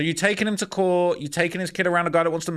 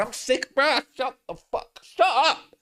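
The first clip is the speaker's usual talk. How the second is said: fed-up yell; 7 semitones higher, slower than usual. Real, not acted. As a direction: yell shut up in disgust. Reactor fed up, yelling.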